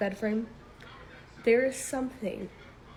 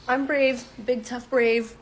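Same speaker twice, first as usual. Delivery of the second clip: louder, self-assured teenage brag, lightly hammed; mock bravado; 3 semitones higher, 6 dB louder, slower than usual. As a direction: puff yourself up to hide fear. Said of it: "so I'm telling myself".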